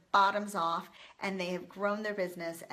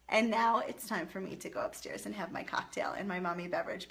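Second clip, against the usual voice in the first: usual speaking voice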